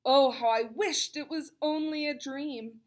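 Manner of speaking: ordinary delivery, nothing marked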